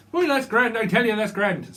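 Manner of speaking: put-on loud, high Irish accent. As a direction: belt it out like a cheerful Irishman. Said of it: "Someone could be from Ireland".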